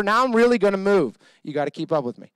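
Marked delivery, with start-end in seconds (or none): none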